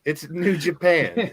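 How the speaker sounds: slow, laughing through the words. Real, not acted. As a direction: say it while cracking up. Corrects the name while laughing.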